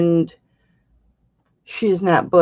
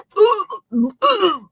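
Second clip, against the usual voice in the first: a high, pointed fake throat clear; mock throat clear; 11 semitones higher than usual. Imitating Nate squirming at her joke, a mock throat clear. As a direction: clear the throat to signal embarrassment. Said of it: "Nate'll be like".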